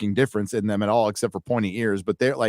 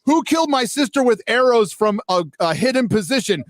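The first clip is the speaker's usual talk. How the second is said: mock challenge; 10 semitones higher than usual. Retelling a show's heroine daring her sister's killer, hammed-up shout. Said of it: "So she's like".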